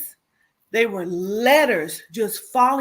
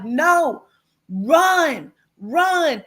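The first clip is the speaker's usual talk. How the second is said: urgent call; 5 semitones higher, 4 dB louder, much slower than usual. Retold or imitated. Warning her sister to flee.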